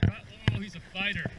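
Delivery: ordinary delivery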